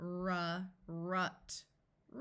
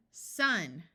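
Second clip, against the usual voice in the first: sounds blended together; quick blended word; said fast; 3 semitones lower, 3 dB louder than usual. Shown as a demonstration. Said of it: "Say it fast".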